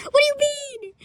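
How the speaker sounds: high-pitched, drawn-out disbelief; amused high-pitched outcry; incredulous squeal. Real, not acted. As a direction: squeal it out, voice shooting way up. Mocking incredulity, replaying the clip.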